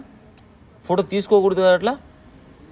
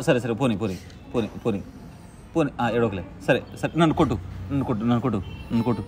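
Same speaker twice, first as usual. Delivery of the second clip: soothing; 9 semitones lower, 5 dB quieter than usual. Real, not acted. Comforting crying child.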